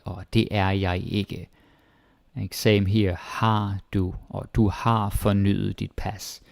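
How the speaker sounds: normal talking voice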